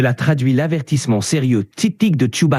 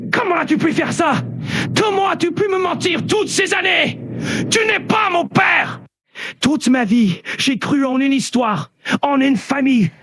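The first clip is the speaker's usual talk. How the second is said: high-pitched, full-lung angry outburst; furious shouting; 7 semitones higher than usual. Acted. Luke bawling out his father after learning he is Darth Vader, very angry shouting. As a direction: yell your outrage at the father who betrayed you. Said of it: "il va falloir que tu cries très fort".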